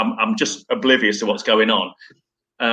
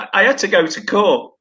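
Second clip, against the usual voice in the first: usual speaking voice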